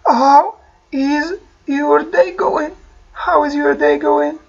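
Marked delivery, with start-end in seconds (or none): 0.1-2.7 very slow teaching model; slow model phrase. Shown as a demonstration. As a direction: stretch each word out. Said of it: "أعود أسأل". (ببطء شديد)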